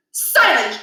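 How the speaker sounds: stern command. Acted. Principal commands.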